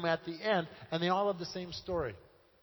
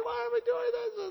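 put-on much higher, puzzled whimper of a teenage gang boy; tough boys baffled by their tears; 3 dB louder than usual. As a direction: play a tough kid confused to find himself bawling. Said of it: "They're just bawling".

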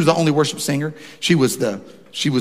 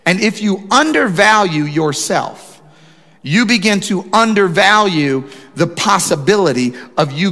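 preaching; 3 semitones higher than usual. Acted. Pastor preaching to his church, impassioned. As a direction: drive the point home to the congregation.

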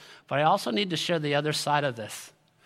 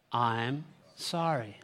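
slow, soft, word-by-word condolence; low, measured sympathy; leading a recital; 4 semitones lower, 4 dB quieter, much slower than usual. Shown as a demonstration. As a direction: lead the room gently through the words. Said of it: "Let's say that together".

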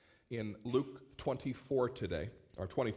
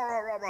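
higher imitation of heavy breathing; mock breathing; 10 semitones higher, 5 dB louder than usual. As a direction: puff out the toddler's breathing sounds. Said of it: "I continue to hear the rah".

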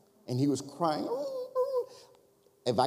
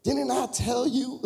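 high, slow, drawn-out told-you-so; mock high voice of the wife; wife's I-told-you-so; 12 semitones higher, 4 dB louder than usual. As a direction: tease him like the wife who was right. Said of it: "here comes the voice of God".

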